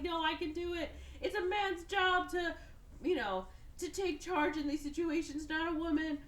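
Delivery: high-pitched, imitated bickering; mock insistence. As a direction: argue back in a high, hammed-up voice. Acted fight over the controls.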